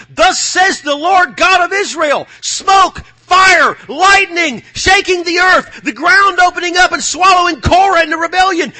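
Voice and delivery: high, loud, slow thundering preacher; shouting preacher listing the signs of God; preaching climax. Real, not acted. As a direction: thunder it out at full force. Climax preaching at full force.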